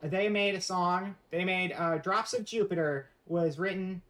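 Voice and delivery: normal talking voice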